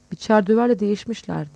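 ordinary delivery, nothing marked

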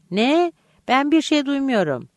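high "Ne?", then a high protest; protesting disbelief. A narrator's reading. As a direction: deny it in high, puzzled indignation. Boy denies hearing his mother call.

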